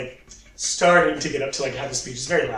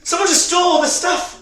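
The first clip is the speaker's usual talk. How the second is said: high, alarmed outburst; alarmed shopkeeper; 11 semitones higher than usual. Acted. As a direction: cry out that you've been robbed. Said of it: "she's like".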